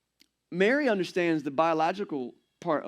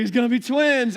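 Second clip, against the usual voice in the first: higher and louder, stunned; mock panic over twins; shocked announcement; 6 semitones higher, 6 dB louder than usual. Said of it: "He was like".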